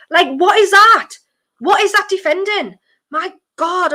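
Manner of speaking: high, loud exasperated outburst. Real, not acted. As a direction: vent at the terrible defending. Exasperated at defending.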